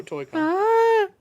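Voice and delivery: excited squeal. Acted. Excited cry of event name.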